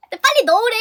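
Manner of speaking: loud and high, hurrying someone; urgent urging. Retold or imitated. Stranger urging her to bag her things, loud high urging. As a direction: raise the voice into an insistent order.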